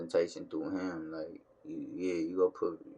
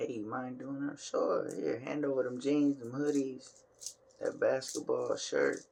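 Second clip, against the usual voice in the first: lightly voiced higher back-and-forth; mock exchange; 8 semitones higher than usual. Vlogger acting out being asked to wash his daughter's laundry, lightly higher. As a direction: play both sides of a casual ask, a bit higher. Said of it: "It's like".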